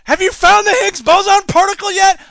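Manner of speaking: high, loud, excited question; eager scientist. Acted. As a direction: play a breathless physicist. Voicing an eager physicist.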